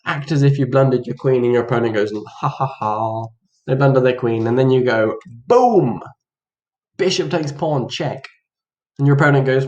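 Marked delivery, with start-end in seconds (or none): 2.1-3.3 slow, low, spoken "ha ha ha"; mock gloating laugh. Opponent gloating over a blundered queen, mock laugh. 5.4-6.0 high, punchy burst; triumphant exclamation. Acted. Player springing the trap on opponent, triumphant.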